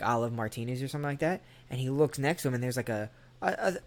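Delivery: ordinary talk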